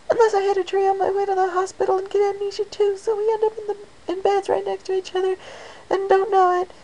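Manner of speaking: very high, bubbly chatter; high Pinkie voice. Acted. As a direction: babble on excitedly.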